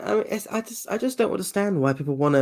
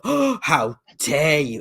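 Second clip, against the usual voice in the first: slow, drawn-out, exaggerated gasp of offence; mock outrage; 2 semitones higher, much slower than usual. Mocking an entitled person, slow and exaggerated outrage. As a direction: ham up the indignant, entitled victim. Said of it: "It's the fucking entitlement of".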